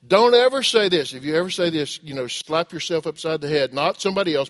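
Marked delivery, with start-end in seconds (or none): 0.1-1.1 emphatic warning. Real, not acted. Preacher warns the congregation.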